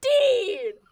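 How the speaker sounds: high, loud outburst of shock; shocked yell. Real, not acted. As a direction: shout it, scandalised. Shocked by the Xbox line.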